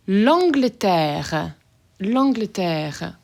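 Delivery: slow, careful French L'Angleterre with nasal an; French pronunciation. Shown as a demonstration. Teacher modeling country names, slow careful French. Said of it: "So here we go".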